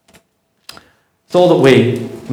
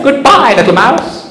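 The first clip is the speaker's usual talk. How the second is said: panicked fox; 10 semitones higher than usual. Acted. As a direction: yelp it out as the fox bolts. Fox fleeing in story.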